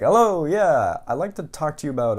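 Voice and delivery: mock cheery phone greeting. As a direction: sound overly friendly on the phone.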